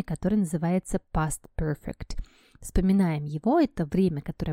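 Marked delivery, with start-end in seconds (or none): none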